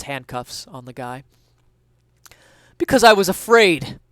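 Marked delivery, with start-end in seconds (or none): 2.8-4.0 raised thief voice. Acted. Thief answers the cop.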